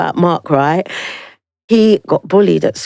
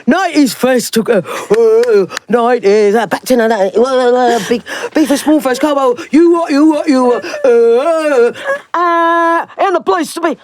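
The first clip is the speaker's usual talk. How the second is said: excited; 3 semitones higher than usual. Real, not acted. Excited rambling about nineties fest.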